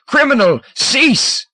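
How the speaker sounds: very high, loud command; roaring command. A narrator's reading. Hegrin commanding Scomber to cease, roaring. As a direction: order him to stop at once. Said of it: "Hegrin's mental roar".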